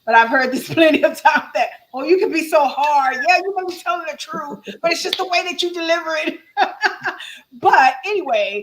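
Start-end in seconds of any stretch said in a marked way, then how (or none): 1.9-6.4 higher-pitched mock protest from a critic; imitated complaint. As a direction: voice their objection in a raised pitch. Quotes critics' recurring complaint.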